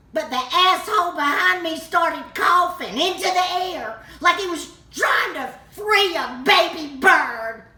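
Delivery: loud, high-pitched outburst of indignation; shouted, incredulous complaint; outraged rant. Acted. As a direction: explode in exasperation at someone's behaviour. Outraged at the man coughing.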